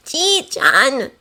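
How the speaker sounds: ordinary delivery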